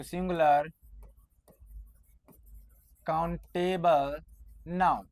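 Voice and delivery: Singular countable noun said slowly, word by word with pauses; slow dictation. Shown as a demonstration. Teacher repeats the rule slowly so students can note it, word by word. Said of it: "कहाँ होगा?".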